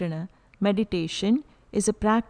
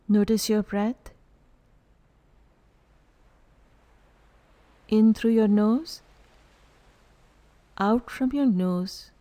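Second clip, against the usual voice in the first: calm guided meditation with long pauses; unhurried, evenly spoken meditation instructions. Real, not acted. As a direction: lead them into stillness, leaving silence between phrases. Leads a breath meditation.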